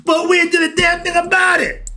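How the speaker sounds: high, shouted rebuke; preaching shout. Real, not acted. Street preacher shouts at the crowd about ignorance. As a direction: blast it at the crowd in sudden anger.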